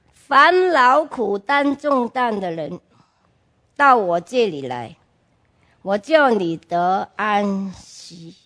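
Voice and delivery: slow, measured verse reading with long pauses; solemn scripture recitation. Retold or imitated. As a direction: recite the verse slowly, like a comfort. Reciting a remembered Bible verse. (缓慢庄重地背诵经文)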